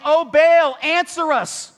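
loud pleading cry to a god; calling out. Retold or imitated. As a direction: call out to Baal, high and loud. Prophets calling out to Baal.

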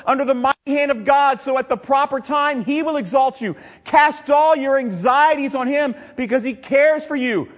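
loud, high scripture preaching. Acted. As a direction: proclaim the verses with full force.

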